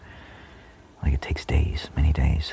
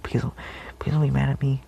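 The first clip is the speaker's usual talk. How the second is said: put-on higher childlike plea through a laugh; mock pleading child; 8 semitones higher than usual. Imitating a modest child begging not to be scolded. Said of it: "a modest child".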